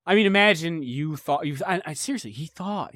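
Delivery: normal talking voice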